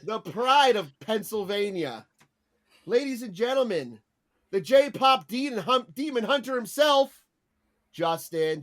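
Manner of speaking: mock ring announcer build-up; lightly hammed-up emcee; announcer intro. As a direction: hype the guest like a boxer. Announcer-style guest intros.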